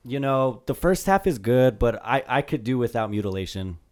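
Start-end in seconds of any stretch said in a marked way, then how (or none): none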